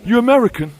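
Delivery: high, loud eager question; excited local asking if he's American. Retold or imitated. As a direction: chirp it like a hopeful local. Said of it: "They're always so disappointed".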